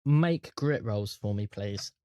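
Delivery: ordinary delivery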